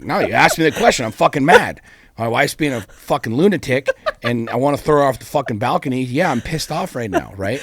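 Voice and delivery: loud, high angry rant; retelling an angry reply to a fan, loud and high. Retold or imitated. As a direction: let it rip at the questioner. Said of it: "I'm like".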